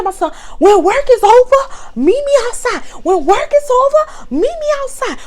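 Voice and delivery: very high put-on challenge to fight; mock challenge. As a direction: call her outside, hammed up. Coworker calls her out, retold.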